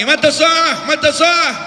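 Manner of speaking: high, loud calling out to the Prophet; shouting villager. Retold or imitated. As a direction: bellow it from the back of the crowd. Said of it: "Dia teriak-teriak". (seruan keras dan tinggi)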